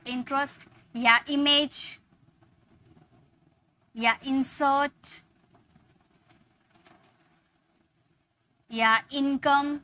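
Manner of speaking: इमेज, इंसर्ट, इनकम said slowly with a short i; slow deliberate demo of each i word. Shown as a demonstration. Teacher models the i words slowly. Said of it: "सभी की pronunciation ई में".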